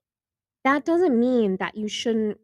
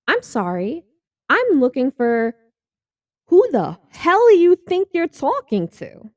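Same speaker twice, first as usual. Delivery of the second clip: builds to a loud, slow, high retort; starts polite, ends in a loud, high mock outburst; indignant outrage; 7 semitones higher, 8 dB louder, slower than usual. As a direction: put the rude complimenter in their place. Acting out offense at a compliment.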